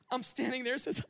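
ordinary talk, nothing marked

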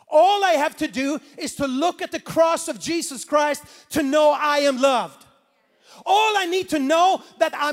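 rising, loud preacher's build; loud, swelling sermon climax; preaching at full force. Acted. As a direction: preach it to the rafters about the cross.

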